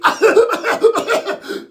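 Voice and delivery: high-pitched hacking fit; coughing fit. Acted. Sick boy greeting classmates, breaking into coughs. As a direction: hack uncontrollably.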